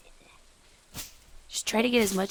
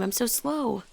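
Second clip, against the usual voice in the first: usual speaking voice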